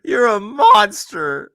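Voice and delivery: loud, high, drawn-out accusation; laughing disbelief, hammed up; mock outrage. Real, not acted. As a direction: stretch MONSTER out in playful horror. Mock outrage at dead bird story.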